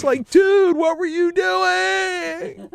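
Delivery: high, loud, slow wail; exasperated shout. Retold or imitated. Scott and Brian wailing at Sam in high, loud disbelief. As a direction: cry it out in disbelief. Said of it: "they went".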